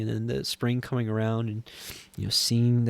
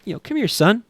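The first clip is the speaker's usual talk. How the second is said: higher, louder voice of a father beckoning; acted fatherly call, pitched up; Father calling his son; 4 semitones higher, 6 dB louder than usual. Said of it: "this is the Father saying".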